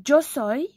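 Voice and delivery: "yo soy" with y said as dj; demonstrating pronunciation. Shown as a demonstration. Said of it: "ça se prononce yo soy".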